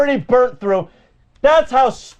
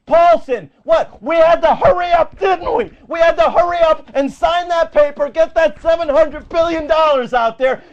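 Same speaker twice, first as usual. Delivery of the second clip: higher-pitched, indignant rant; outraged outburst; 5 semitones higher than usual. Real, not acted. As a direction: cut in, incredulous and heated. Outraged reaction to the clip.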